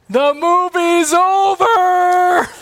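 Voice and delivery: imitating a wailing child. Dad imitates his daughter wailing up the stairs, a high slow wail. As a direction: wail it like a heartbroken little girl.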